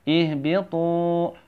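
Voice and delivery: "Ihbitu" slowly with a lengthened final tu; deliberate chanted "Ihbitu"; long tu. Shown as a demonstration. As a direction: hold the tu of "Ihbitu" for two beats. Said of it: "Tu-nya dipanjangin dua harokat".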